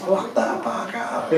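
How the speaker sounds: high, strained mock scream, held back in volume; drawn-out, high impression of a screaming woman; imitated scream. Annie finding a biker with a knife, mock screaming. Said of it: "woke up with Annie screaming".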